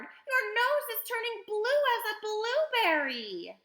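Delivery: high-pitched, loud scream; panicked mother screaming; screamed in alarm. A narrator's reading. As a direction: scream the whole sentence in alarm. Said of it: "screamed Missus Beauregard".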